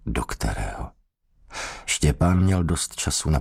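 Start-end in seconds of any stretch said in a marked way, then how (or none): 0.1-0.9 much softer and lower, to himself; inner echo. A narrator's reading. Prisoner repeats the threat to himself, hushed. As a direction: let the words sink in, hushed.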